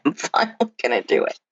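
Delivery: quick, half-laughing mock conviction; laughing through it. Laughing at her old diet hopes, to the host. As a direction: laughs mid-line at her old self. Retold excited new-diet high.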